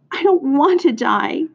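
high-pitched plaintive wail; tearful moan. A narrator's reading. Pig bursts into tears over his fate, moaned. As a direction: narrator acting a miserable little pig. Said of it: "he moaned".

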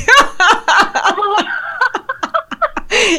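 big high-pitched laugh. Real, not acted. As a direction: burst out laughing. Laughing at own joke.

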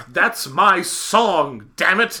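loud, drawn-out diva protest; hammed-up indignant star; mock indignant outburst. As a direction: stretch the words in theatrical outrage. Acted outrage of singer almost quitting.

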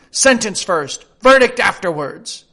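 a shrill-sounding, loud Queen of Hearts. Acted. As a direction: cut him off like a tyrant. Queen insists on sentence.

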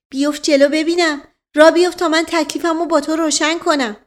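high, loud furious order; angry command. Acted. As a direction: bark him forward like a furious matron. Azizolsaltaneh strikes Naib with stick. (فرمان خشمگین و بلند)

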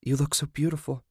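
high-pitched admiring coo; gushing mother. A narrator's reading. Mother admiring bride on wedding day, gushing. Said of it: "her mother had gushed".

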